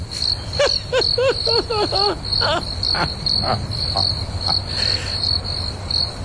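breathy cackle at own pun; long airy laugh. Real, not acted. A teacher laughs at his own pun, long and airy.

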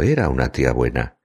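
usual speaking voice

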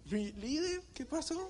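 high mock greeting from the cops; policemen greeting. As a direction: pitch up playing the cheerful cops. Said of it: "se me acercaron los policías". (policías saludando en tono agudo)

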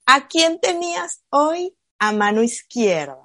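slow, careful mother asking; slow questioning mom; slow gentle question. Retold or imitated. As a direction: draw the question out slowly. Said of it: "Entonces yo le digo".

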